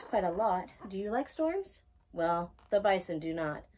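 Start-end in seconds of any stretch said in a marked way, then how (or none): none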